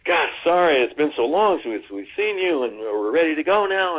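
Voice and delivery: imitating Steven. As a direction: play the busy director breezing in. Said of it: "he comes in and says".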